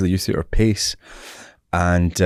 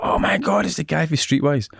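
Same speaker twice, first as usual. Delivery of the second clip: starstruck; 9 semitones higher than usual. Retold or imitated. Recognising TV star in corridor.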